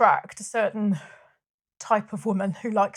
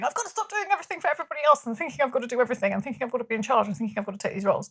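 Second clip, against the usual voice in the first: fast, piled-up list of retold complaints; rapid fed-up outburst; 2 semitones higher, much faster than usual. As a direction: rattle it off in one rush. Retelling her own fed-up outburst.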